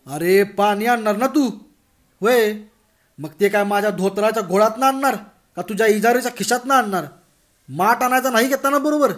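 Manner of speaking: loud, sarcastic telling-off; mocking scold. Patil sarcastically scolding Bhagwan for forgetting the pot, loud. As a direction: rub his nose in it.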